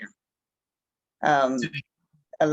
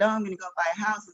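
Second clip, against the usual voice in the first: higher, fast and eager, imitating a new buyer; mock excited buyer; 6 semitones higher, much faster than usual. Agent voicing an excited new buyer, mock eager. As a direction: play the naive eager buyer. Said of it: "you're excited".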